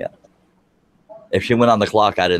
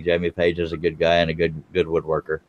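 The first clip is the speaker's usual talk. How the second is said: ordinary delivery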